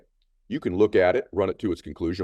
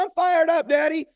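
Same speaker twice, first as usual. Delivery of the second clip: very high, louder cry to a father; imitated excited child; 5 dB louder than usual. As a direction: mimic a pumped-up daughter. Daughter shouting the phrase back.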